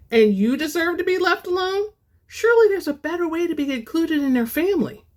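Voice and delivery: lightly voiced scoffing schemer; scoffing companion. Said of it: "his companion scoffed".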